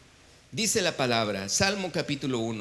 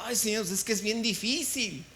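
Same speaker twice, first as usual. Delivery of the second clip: whiny complaint; 4 semitones higher than usual. Retold or imitated. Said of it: "Y yo digo".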